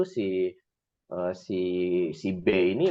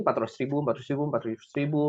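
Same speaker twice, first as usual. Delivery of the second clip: quick, rattled-off repetition; fast counting; 6 semitones higher, much faster than usual. Real, not acted. Counting monthly savings rapidly.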